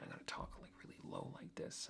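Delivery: very soft breathy whisper, barely there. Shown as a demonstration. Testing dictation on camera, whispering very quietly. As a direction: drop to a whisper to test the app. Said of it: "whispering very quietly".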